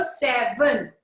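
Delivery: SEVEN stretched into two beats, se-ven; two distinct syllables. Shown as a demonstration. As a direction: say "seven" slowly, separating both syllables. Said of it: "It's a two-syllable word".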